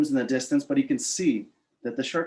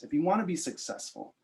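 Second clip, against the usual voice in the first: ordinary talk, nothing marked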